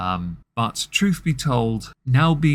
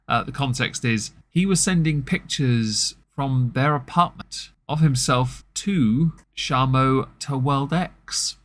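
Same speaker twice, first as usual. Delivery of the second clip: normal talking voice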